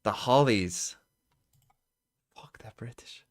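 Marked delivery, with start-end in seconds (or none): 2.4-3.2 whispered curse to self; hushed annoyed whisper. Real, not acted. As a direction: whisper it in frustration. Muttering answers under breath.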